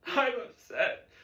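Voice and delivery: high, slow forced cry; cowed student straining to obey. Acted. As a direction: push the voice up without much power.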